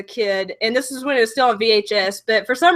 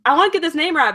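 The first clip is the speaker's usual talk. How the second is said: normal talking voice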